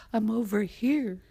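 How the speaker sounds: lightly voiced wind calling back. North Wind answering the boy, lightly calling out. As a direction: raise the pitch a little, calling from afar.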